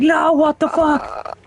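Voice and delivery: very high, drawn-out mock panic; hammed-up squealing protest, slow and high; horrified refusal. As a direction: act out a boy recoiling in horror. Retold boy recoils from Turbo Granny.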